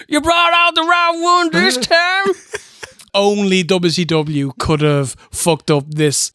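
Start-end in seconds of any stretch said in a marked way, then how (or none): 0.1-2.3 higher, laughing jeer; mock crowd taunt. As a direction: taunt him while laughing. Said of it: "they're laughing at him going".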